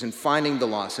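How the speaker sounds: normal talking voice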